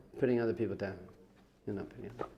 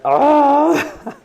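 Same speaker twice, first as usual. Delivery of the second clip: high, very loud drawn-out "aah"; long agonised scream; 14 semitones higher, 16 dB louder than usual. Acted. Re-enacting the eye stabbing for the class, loud agonised scream. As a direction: scream in mock agony at full voice.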